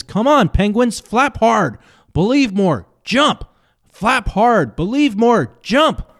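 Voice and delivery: high, shouting motivational speaker; relentless life coach. Retold or imitated. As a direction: pump them up, pitch way up. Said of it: "He says".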